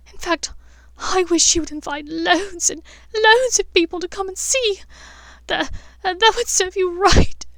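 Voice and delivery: put-on high, loud girl's voice close to crying; Hermione's tearful outburst. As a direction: hurl it at her in a high, breaking wail.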